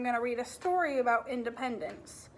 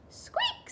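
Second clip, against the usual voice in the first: very high mouse squeak; tiny squeak; 3 dB quieter than usual. Acted.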